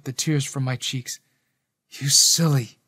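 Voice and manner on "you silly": lightly hoarse fond reproach; understated husky, weakened voice; still hoarse. A narrator's reading. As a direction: say it with a touch of hoarseness. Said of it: "he said, his voice still hoarse".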